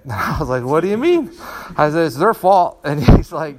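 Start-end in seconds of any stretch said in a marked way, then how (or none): 0.6-1.3 high, louder retold objection; indignant protest. Student objecting to Sifu's correction, mock-indignant high voice. 2.1-2.8 high, loud mock complaint; indignant protest. Frustrated student blaming others to Sifu, loud high protest.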